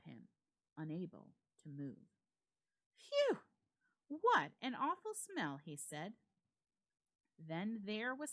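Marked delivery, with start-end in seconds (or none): 2.9-3.4 high, loud phew of disgust. Acted. Friend opening the door to a foul smell, disgusted phew. 4.1-5.6 disgusted. Acted. Reacting to the stench.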